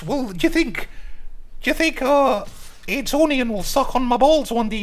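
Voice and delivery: very high squeaky mock voice of a dumb chatter. Mimicking his dumb chat to the stream, high and squeaky. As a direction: squeak it out like a silly kid in chat. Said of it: "they're like".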